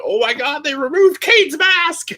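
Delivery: high-pitched mock-horrified fan squeal; freaking out. Fan retells watching Kane's unmasking, freaking out. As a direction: relive a young fan's shock, pitch soaring. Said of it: "like, freaking out".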